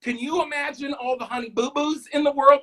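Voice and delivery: ordinary talk, nothing marked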